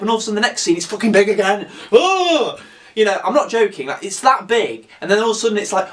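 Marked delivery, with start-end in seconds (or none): 1.9-2.6 high, startled yell; hammed-up shock; mock alarmed cry. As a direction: cry out in comic horror. Reacting as shark grows big again.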